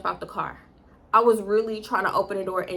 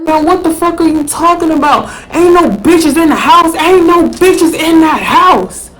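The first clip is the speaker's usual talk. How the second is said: mock shouting boyfriend; 8 semitones higher, 16 dB louder than usual. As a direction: bellow it like he's caught and furious. Boyfriend yelling, denying.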